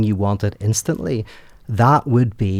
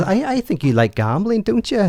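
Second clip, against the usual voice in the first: much higher, sly sing-song question; Pip teasing; 9 semitones higher than usual. Retold or imitated. Pip slyly tempting Rocky, voiced high and knowing. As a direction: coax him knowingly, like you hold a secret. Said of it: "He says".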